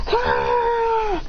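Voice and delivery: very high held yell; power-burst scream. Acted. Everyone sent flying by his power, high held scream. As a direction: let out one long scream.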